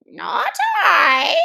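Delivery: quick, high, loud denial; hasty Lory. Acted. Lory hastily denying to the Mouse, high. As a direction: blurt it out fast to dodge blame. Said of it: "said the Lory hastily".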